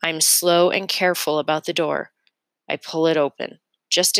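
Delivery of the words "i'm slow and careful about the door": ordinary delivery, nothing marked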